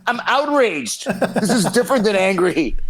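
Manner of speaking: hammed-up righteous denial; mock outrage. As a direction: insist you're not angry, just outraged. Coach denying anger, mock indignant.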